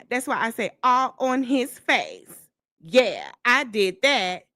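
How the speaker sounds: mock her tough talk with exaggerated swings; mocking imitation. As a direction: play her bragging, stretching the phrases. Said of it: "exactly what you sound like".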